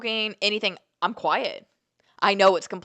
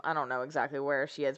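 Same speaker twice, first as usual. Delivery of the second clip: ordinary talk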